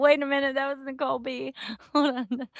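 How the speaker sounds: high, giggly words through real laughter; laughing. Real, not acted. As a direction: try to talk while cracking up. Laughing again at the clip.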